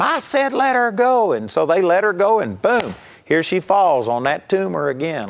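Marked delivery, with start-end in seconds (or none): 0.0-1.3 much higher, emphatic mock yell; retold yell. Smith yells at the women, raised command. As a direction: repeat the order with force. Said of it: "And he yelled at 'em".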